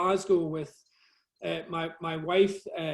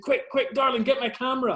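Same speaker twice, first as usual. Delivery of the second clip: higher, hurried mock urgency; excited urgent call; 7 semitones higher than usual. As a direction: retell the rush to grab the camera. Retold urgent call to wife.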